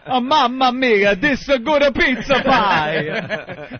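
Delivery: put-on slow, high, loud hammy Italian accent. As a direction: stretch it out in a cartoon Italian accent. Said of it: "the guy wearing the big hat".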